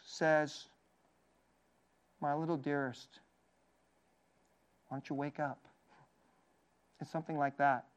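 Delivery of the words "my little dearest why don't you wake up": put-on soft, low endearments to a little girl; tender. Pastor voicing Jesus to the dead girl, tender and soft. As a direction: hush it like waking your sweetheart. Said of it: "It's extremely tender".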